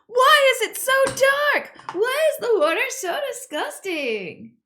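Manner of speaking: loud, high-pitched drawn-out wail; wailing complaint. Real, not acted. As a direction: cry out in loud dismay. Wailing complaint about dark, dirty water.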